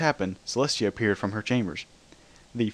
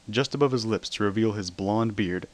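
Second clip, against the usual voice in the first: usual speaking voice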